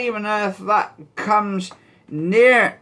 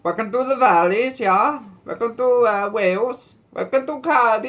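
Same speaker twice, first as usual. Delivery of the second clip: put-on higher-pitched, playful Welsh accent; mock Welsh welcome, pitched up; 5 semitones higher than usual. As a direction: put on a Valleys Welsh lilt. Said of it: "That sounds, that sounds Welsh".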